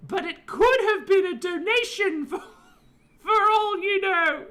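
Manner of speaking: high, loud mocking impression; shrill parody of an angry streamer. Mocking Foodie's angry reply.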